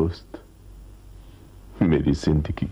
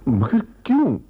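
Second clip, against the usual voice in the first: high, loud cry of disbelief; pained, high-pitched outburst; anguished protest; 5 dB louder than usual. Acted. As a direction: cry out the question in hurt protest. Protests her plea to turn back.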